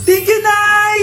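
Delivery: high, loud burst of gratitude; acted overjoyed child; delighted thanks. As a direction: squeal thanks to mother. Child gets new cellphone.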